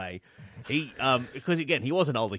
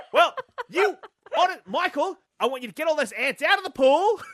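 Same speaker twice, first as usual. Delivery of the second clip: high, louder hammed-up mum scolding; mock mum scolding; 11 semitones higher, 4 dB louder than usual. Mum ordering Michael to clear the pool, high mock scold. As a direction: boss him about like a fussed mum.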